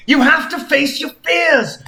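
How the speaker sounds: high, rousing hero voice; pep talk, pitched well above the narration; urging Sonic. Acted. Sonic urges Anne Frank to be brave, high and rousing.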